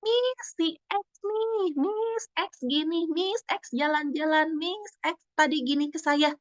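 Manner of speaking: put-on high, drawn-out child voice reporting a classmate; tattling schoolchild. Classmates point out X to the teacher, imitated high sing-out tattling. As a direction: mimic little kids whining to the teacher, pitched high and slow. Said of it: "temennya itu sering banget nunjuk".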